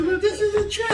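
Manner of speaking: high, wide-eyed disbelief to the Lord; mock amazed prayer. Pastor marveling to the Lord at his healing, high and amazed. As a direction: squeal it up high in wonder. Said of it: "I said".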